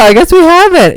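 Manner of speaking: high-pitched mock realization; feigned realization. Acted feigned realization in retold chat.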